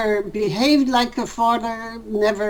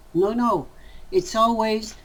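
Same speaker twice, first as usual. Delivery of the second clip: ordinary talk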